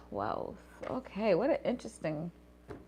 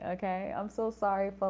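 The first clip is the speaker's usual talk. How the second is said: ordinary talk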